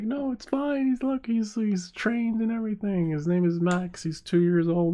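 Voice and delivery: very high-pitched defensive pitch; acted nervous sales pitch; pleading reassurance. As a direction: talk up the dog in a squeaky plea. Husband sells dog to wife.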